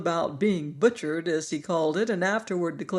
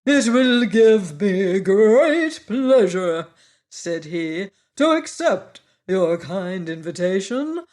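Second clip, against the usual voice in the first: loud, slow and courtly; bow with your voice; grand insect accepting the invitation; gracious Wogglebug; 5 semitones higher, 8 dB louder than usual. A narrator's reading. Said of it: "bowed with a profound grace".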